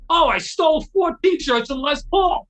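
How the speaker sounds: high, loud imitation of a braggart; gleeful boast. Harry brags in the car about his haul, mock gleeful.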